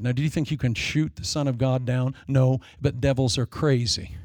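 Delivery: normal talking voice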